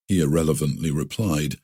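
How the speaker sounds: usual speaking voice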